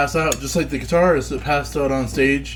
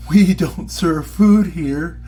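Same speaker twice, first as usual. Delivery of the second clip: slow, drawn-out punchline delivery; deadpan bartender punchline; 3 semitones higher, 5 dB louder, much slower than usual. Retold or imitated. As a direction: drag out the punchline slowly. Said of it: "Bartender says".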